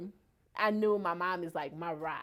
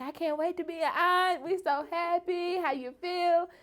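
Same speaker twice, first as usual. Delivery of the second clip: high-pitched, gushing mock excitement; excited friends; 11 semitones higher than usual. Friends texting back about being an aunt, excited. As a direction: imitate thrilled best friends, higher voice. Acting out friends' happy texts.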